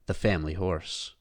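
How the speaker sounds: ordinary delivery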